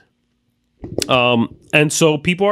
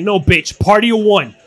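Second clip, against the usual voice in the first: mock rude brush-off; 5 semitones higher, 4 dB louder than usual. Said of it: "He's like".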